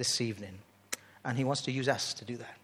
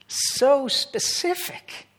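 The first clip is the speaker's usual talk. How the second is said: marvelling; 13 semitones higher, 7 dB louder, much slower than usual. Real, not acted. Marvelling emphasis.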